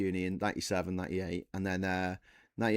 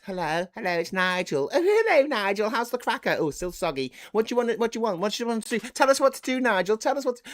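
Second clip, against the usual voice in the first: very high, eager chorus of sycophants; fawning newspaper friends; 12 semitones higher, 5 dB louder than usual. Acted. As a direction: gush excitedly, pitched right up. Farage's press friends fawning over him.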